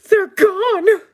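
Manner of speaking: very high, loud wail; piercing cry of despair; panicked waitress. Acted. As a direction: wail it in falsetto as a distraught waitress. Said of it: "the waitress says".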